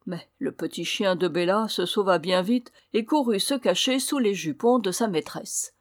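plain reading voice

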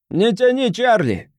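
high, impatient order; commanding. A narrator's reading. Voice from the crowd pressing Charlie, commanding. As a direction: order him to get on with it. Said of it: "скомандовал голос из толпы".

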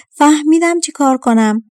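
eureka, the servant finds a way out; happy realization. A narrator's reading. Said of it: "با خوشحالی به دختر گفت".

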